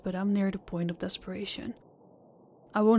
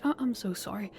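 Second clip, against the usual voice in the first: ordinary talk, nothing marked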